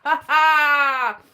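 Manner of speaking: big laugh at own pun; booming self-amused laugh; loud laugh. Real, not acted.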